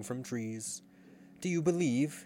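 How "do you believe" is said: high-pitched appeal; cried out. A narrator's reading. Peter appealing to all dreaming children, crying out. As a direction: call it out, pitched up. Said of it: "he cried".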